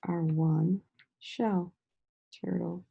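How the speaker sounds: slow, drawn-out our one shell; slow syllable count. Shown as a demonstration. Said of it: "Let's color".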